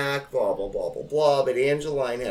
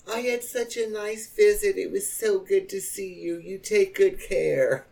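higher, sugary text voice; mock fake sweetness; 7 semitones higher than usual. Reading the cousin's text aloud, mock saccharine. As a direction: read it sweet as pie, falsely. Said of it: "I got a text".

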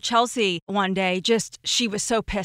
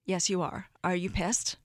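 softly quoted, low admission; hushed retold confession; 4 semitones lower, 8 dB quieter than usual. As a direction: drop low and soft for her answer. Retold quiet confession at coffee.

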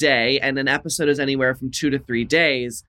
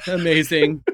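ordinary talk, nothing marked

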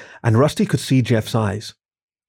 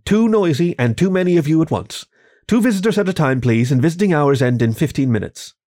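higher, brisk scolding nurse; 6 semitones higher than usual. Acted. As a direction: shoo them out, higher and firm.

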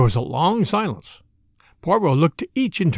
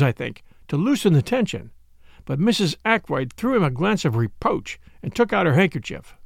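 ordinary delivery

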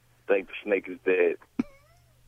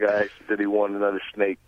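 normal talking voice

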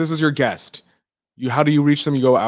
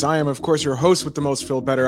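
usual speaking voice

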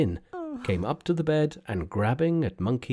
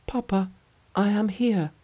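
faint daughter's voice; 10 semitones higher than usual. Acted. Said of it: "answered with a faint".

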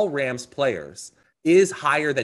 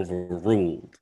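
Southern judge; 7 semitones lower than usual. Acted. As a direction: pronounce it as a Southern movie judge, pitched down. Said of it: "accent of you doing the judge".

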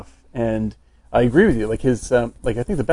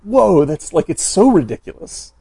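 louder, higher amazed exclaim; amazed disbelief; 6 semitones higher, 6 dB louder than usual. Retold or imitated. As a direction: react in delighted disbelief. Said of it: "I was like".

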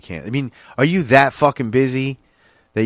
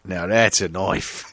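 slow Aussie catchphrase; mock Australian accent; 4 semitones lower, much slower than usual. Joking after the accent slip, mock Australian accent. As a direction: take your time, fake Australian accent.

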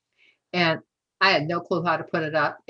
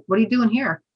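blurted out; 6 semitones higher, 4 dB louder, faster than usual. Retold or imitated. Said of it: "it just came out of my mouth".

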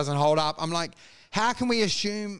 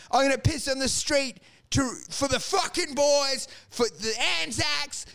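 put-on drunk patriotic shout; 6 semitones higher than usual. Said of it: "you end up going".

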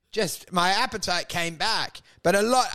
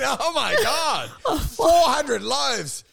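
slow, higher, amazed; mock shocked gasp; 6 semitones higher, slower than usual. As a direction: drag out the disbelief. Mock customs officer shock.

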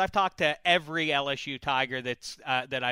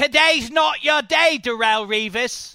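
loud, high British trash talk; British accent; 8 semitones higher, 7 dB louder than usual. Shown as a demonstration. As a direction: taunt in a put-on British accent. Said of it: "Can you do a British accent".

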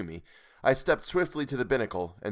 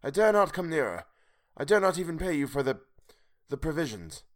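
higher, faltering plea; halting old man; 7 semitones higher than usual. A narrator's reading. Old man afraid to approach, halting. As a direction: falter before the last words.

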